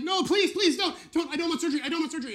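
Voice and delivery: high, loud, frantic pleading; begging patient. Retold or imitated. Patient on the table begging the surgeon, loud frantic pleading. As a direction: plead like you're about to be cut open.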